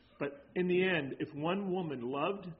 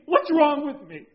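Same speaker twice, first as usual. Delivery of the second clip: higher, louder desperate plea; pleading cry; 9 semitones higher, 13 dB louder than usual. Retold or imitated. Church lady begging the pastor, loud and high. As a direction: throw the question out loud and high. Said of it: "turns to me and says".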